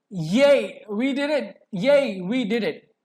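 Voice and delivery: loud, high happy cheering; joyful cheer. Shown as a demonstration. Teacher demos joy for students: loud happy cheer. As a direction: cheer like you just won. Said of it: "a strong feeling of happiness or joy".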